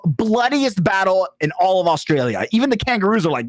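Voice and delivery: usual speaking voice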